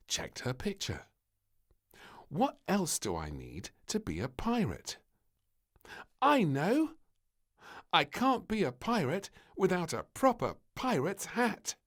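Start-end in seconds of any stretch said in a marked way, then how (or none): 2.3-11.8 put-on high, eager little girl; little princess voice. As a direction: think it through as an excited little girl.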